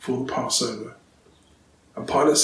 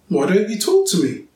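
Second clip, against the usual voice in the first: a pressing, higher demand; 6 semitones higher, 4 dB louder than usual. A narrator's reading. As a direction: insist on an answer. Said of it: "Pilate demanded".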